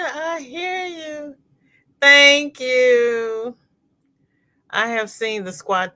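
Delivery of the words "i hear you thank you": fading laugh. Real, not acted. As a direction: let the laugh trail off slowly. Laughing at panda joke.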